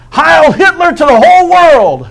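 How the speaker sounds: put-on high, loud boyish holler. Little boy hollering to the whole world, loud and high. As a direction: yell it out across the backyard like a naughty lad. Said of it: "he hollered".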